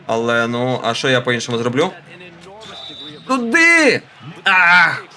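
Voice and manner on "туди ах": excited cry. Real, not acted. Shot attempt in game.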